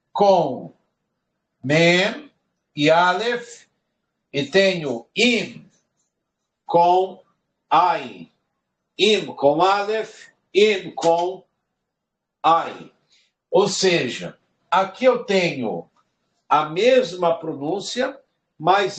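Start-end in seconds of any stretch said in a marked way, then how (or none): none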